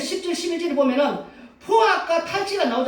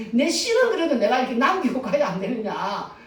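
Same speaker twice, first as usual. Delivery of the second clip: usual speaking voice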